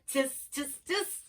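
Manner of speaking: high-pitched monkey chitter; teasing little high tis sounds; mimicking monkeys. A narrator's reading. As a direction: copy him in a squeaky monkey voice. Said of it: "But the monkeys, they stamped their feet".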